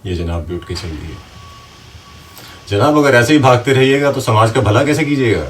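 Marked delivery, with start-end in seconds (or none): 2.7-5.5 loud, higher challenge after someone leaving; calling out. Acted. Writer to a listener walking out, loud reproach. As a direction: shout reproachfully after a deserter.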